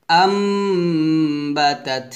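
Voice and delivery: "আম" on a long closed-lip hum, then "বা তাত"; ghunna recitation. Shown as a demonstration. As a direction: stretch the m of "আম" before "বা". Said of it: "গুণ্য করতে হবে". ("আম" লম্বা গুন্না করে)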